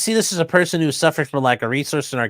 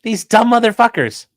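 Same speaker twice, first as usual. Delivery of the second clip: ordinary talk, nothing marked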